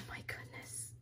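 soft, voiceless amazement; hushed, real awe; awed whisper. Real, not acted. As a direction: whisper it as if overwhelmed with delight. Awed by another piece.

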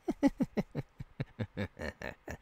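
mocking laugh. Mocks begging captive.

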